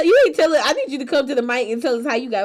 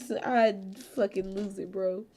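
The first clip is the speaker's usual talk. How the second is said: normal talking voice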